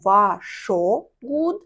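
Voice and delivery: "varsågod" very slow as "va-sho-good"; drawn-out "varsågod" with a long sho; wrong O vowel. Shown as a demonstration. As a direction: stretch a full O in the middle of "varsågod". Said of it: "я не кажу".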